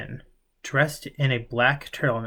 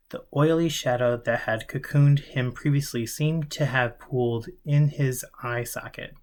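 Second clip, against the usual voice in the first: ordinary delivery, nothing marked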